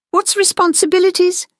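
high-pitched puzzled little monster; wondering aloud. A narrator's reading. Monster Fester puzzled by a new word, wondering high. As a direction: ask it high, not knowing the word. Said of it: "Fester wondered aloud".

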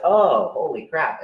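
acted-out wow moment; mock amazement. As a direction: stretch it out in dawning surprise. Voicing her sudden realisation.

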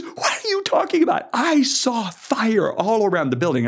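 high, upset cry of protest; mad. Retold or imitated. Singer angrily insisting on fire she saw. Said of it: "she got mad at me".

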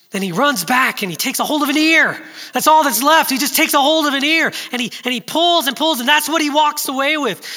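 loud, high, climbing preacher's cry; preaching at full force. Real, not acted. Pastor preaching loud and high to the congregation, the shepherd's desperate rescue. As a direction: build it to a shout for the congregation.

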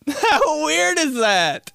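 high, stretched words through laughter; real laughing. Real, not acted. Amazed at dad's fire bragging, real high laughter. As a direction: crack up while saying it.

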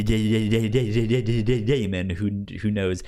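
ordinary delivery

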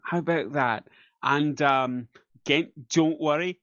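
normal talking voice

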